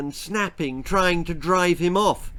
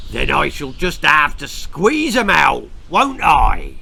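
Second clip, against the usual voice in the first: rough farmer, louder and menacing; 7 dB louder than usual. A narrator's reading. Farmer dangling the little girl threatens to squeeze her, menacing. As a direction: threaten like a cruel yokel.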